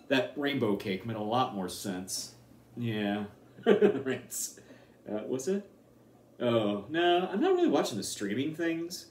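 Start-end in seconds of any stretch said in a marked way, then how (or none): none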